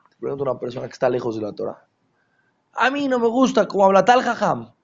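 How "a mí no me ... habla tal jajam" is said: skeptic's complaint. Retold or imitated. Skeptic complains about a rabbi.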